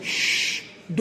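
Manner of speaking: long hushing "shh"; sustained voiceless shush; shushing sound. Acted. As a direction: shush someone with a drawn-out hiss. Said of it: "The tailor said".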